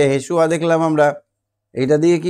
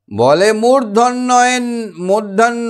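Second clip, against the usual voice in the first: high, slow, drawn-out মূর্ধন্য; stern rhyme voice; 7 semitones higher, slower than usual. Shown as a demonstration. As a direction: stretch মূর্ধন্য like a scolding teacher. (উঁচু সুরে টেনে টেনে মূর্ধন্য)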